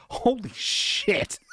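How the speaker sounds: high, slow stunned gasp of disbelief; reading a shocking budget, stunned high exclamation; shocked. Real, not acted. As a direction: blurt it out floored by the number. Shocked by tiny animation budget.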